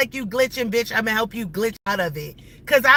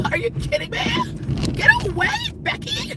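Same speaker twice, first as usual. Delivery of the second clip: high, far-off mock yell; hammed-up shriek at Becky; mock shout. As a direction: throw a high squawk at her.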